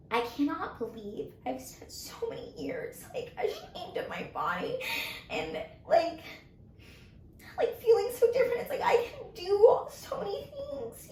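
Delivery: laughing through tears, real emotion breaking in; tearful. Real, not acted. Telling friend about the beach day, crying and laughing. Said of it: "I'm trying not to cry because you're doing".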